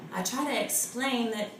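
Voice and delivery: normal talking voice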